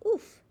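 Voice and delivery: high, loud grunt of pain; pained oof. Acted. Grape bumping his knee on the way out, sharp oof. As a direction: yelp at the bumped knee.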